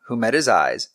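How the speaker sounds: ordinary delivery, nothing marked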